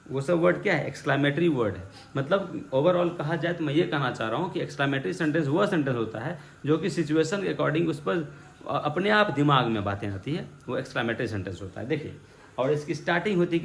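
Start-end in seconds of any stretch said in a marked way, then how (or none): none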